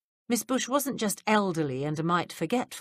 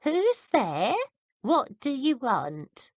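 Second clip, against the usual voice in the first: put-on higher, slow old woman's wary voice; a frail, suspicious old woman, pitched up; quavery old woman; 8 semitones higher, much slower than usual. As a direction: call out like a frail old lady behind the door. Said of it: "a quavery voice said".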